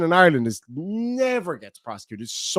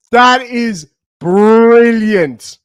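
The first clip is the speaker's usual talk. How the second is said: drawn-out through laughter, louder; laughing; 4 semitones higher, 7 dB louder, much slower than usual. Real, not acted. Streamer praising a viewer's joke, laughing slowly. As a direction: squeeze it out mid-laugh.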